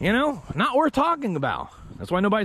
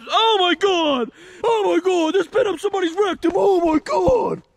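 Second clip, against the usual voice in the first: horrified shouting; 10 semitones higher, 3 dB louder than usual. Acted. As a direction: cry out in hammed-up disgust. Horrified at the found shank.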